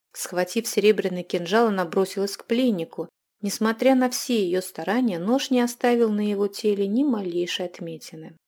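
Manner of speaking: plain reading voice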